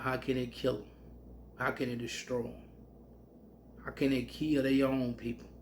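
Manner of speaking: ordinary delivery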